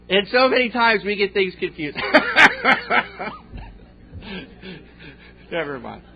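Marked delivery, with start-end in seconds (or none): 2.0-3.8 bright laugh, rising; can't help laughing; real laugh. Real, not acted. Preacher laughing at the joke falling flat.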